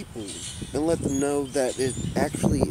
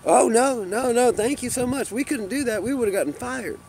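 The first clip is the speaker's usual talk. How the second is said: imitating store employee; 9 semitones higher than usual. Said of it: "But what I hear".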